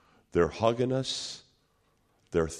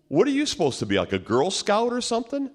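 high, loud mocking question; mocking challenge; 11 semitones higher, 5 dB louder, faster than usual. Crowbar guy sizing up the walkers, mocking. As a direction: jeer it as a taunting question. Tough worker confronts the narrator.

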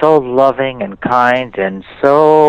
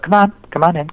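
higher coaxing call to a dog; 8 semitones higher, faster than usual. Retold or imitated. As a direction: call the dog in, lightly voiced. Calling the dog inside.

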